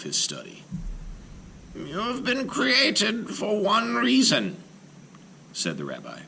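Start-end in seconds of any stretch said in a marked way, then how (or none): 1.7-4.6 high, slow solemn rabbi's voice. Acted. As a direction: lay out the purpose with gravity. Rabbi instructs golem.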